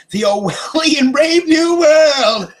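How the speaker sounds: high, loud, drawn-out wail of doom breaking into laughter; over-the-top high-pitched holler; mock-dramatic proclamation. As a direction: slowly howl it out like a crazed announcer. Ranting mock welcome to dystopia.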